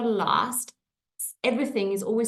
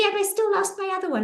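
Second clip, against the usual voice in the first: put-on whiny teen; 12 semitones higher, much faster than usual. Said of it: "And she said".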